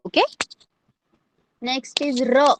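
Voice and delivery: ordinary delivery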